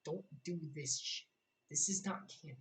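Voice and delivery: ordinary talk, nothing marked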